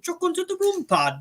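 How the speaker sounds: high squeaky Yorkshire imitation; mock Yorkshire accent. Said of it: "You're like".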